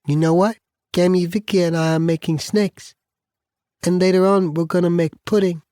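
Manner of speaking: put-on high little-girl voice; small shy child. A narrator's reading. Little daughter telling daddy her plans, high shy voice. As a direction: speak up shyly as a small child. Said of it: "Hearing her small, shy voice".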